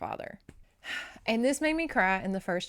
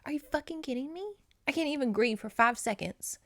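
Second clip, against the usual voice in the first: exasperated disbelief; 7 semitones higher than usual. Real, not acted. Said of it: "exasperated outburst at the plot".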